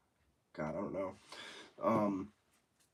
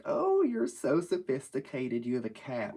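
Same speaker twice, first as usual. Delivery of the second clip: put-on impressed girl voice; 4 semitones higher, 4 dB louder than usual. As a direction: gush like a smitten date. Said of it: "any girl that I brought over, like".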